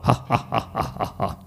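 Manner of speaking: slow, staccato evil "ha ha ha"; voiceless, measured phantom cackle; ghostly laugh. Acted. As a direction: laugh mockingly like a menacing spirit. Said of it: "Kemudian suara tu ketawa".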